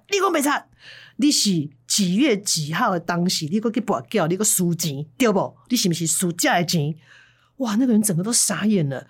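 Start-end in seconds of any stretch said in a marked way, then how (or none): 0.1-7.0 corner the liar with dates; angry scolding. Retold or imitated. Master scolds the lying gambler. (师兄怒骂赌徒说谎)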